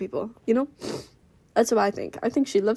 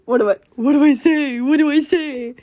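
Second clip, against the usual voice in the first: high, loud acted panic; freaking out; 6 semitones higher, 7 dB louder than usual. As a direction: panic over what to say, hammed-up. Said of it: "freaking the fuck out".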